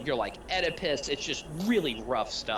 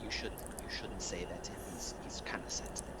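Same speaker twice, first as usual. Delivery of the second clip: whispered, much softer aside; hushed warning; 5 semitones lower, 10 dB quieter than usual. Acted. [name] quietly warning the players about the touchy priest.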